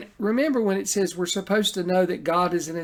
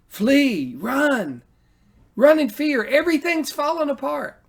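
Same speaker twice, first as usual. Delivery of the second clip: panicked cry; 10 semitones higher, 4 dB louder than usual. Acted. Preacher voicing the soul's panic to the congregation, high fearful cry. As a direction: voice of a frightened soul, high and loud. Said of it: "Why do you say to my mind".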